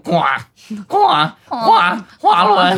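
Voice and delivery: nasal croak, then a high loud call of 花轮; nasal frog voice. Retold or imitated. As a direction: croak through the nose like a frog. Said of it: "有鼻音的呱".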